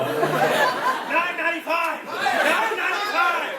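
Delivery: high, excited contestant shout; high-pitched mock bidding; game-show contestant. Imitating Price is Right contestants.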